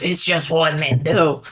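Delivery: lightly voiced swaggering man; a hint of macho bravado; mock macho guy. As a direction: say it like a knowing dude. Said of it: "When you say it like that".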